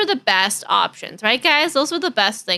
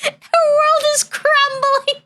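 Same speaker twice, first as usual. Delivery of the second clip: mock wail; 14 semitones higher, slower than usual. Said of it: "mock wail over clown pin".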